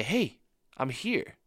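soft, slowed hey I'm here; whisper-close comfort in the storm; gentle Jesus voice. Pretend. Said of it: "hear the voice of Jesus say".

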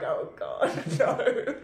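ordinary talk, nothing marked

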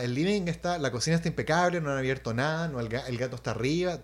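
normal talking voice

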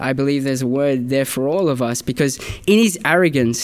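normal talking voice

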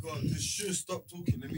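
urgent hushed whisper. Real, not acted. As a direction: whisper to shut them up. Hushing the others mid-chaos.

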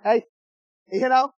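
ordinary talk, nothing marked

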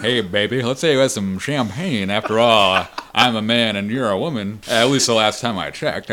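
loud goofy come-on; hammed-up, loud goofy movie voice; really goofy. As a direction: imitate a badly dubbed goofy flirt. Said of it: "starts talking, like, really goofy".

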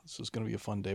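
father dreading the day ahead; dry sarcasm. Retold or imitated. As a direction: mutter it like a tired dad. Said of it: "I was like".

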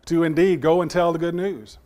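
ordinary talk, nothing marked

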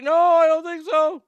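put-on high, loud whimpering child voice; imitating whiny kid. Hurt boy answering his mother, mock whimpering.

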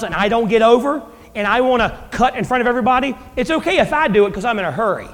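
ordinary talk

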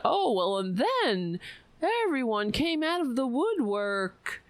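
high, sing-song pundit parody; mocking liberal media. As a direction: parrot the official story sarcastically. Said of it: "the so-called liberal media, talking about".

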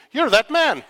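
high, loud excited exclaim; mock excited you're-that-man; excited recognition. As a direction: blurt it out as if spotting him. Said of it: "she points to me".

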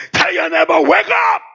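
high, very loud preacher's wake-up call; rousing shout. Real, not acted. Preacher telling the congregation to wake their neighbours, rousing shout. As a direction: shout it out to rouse the whole room.

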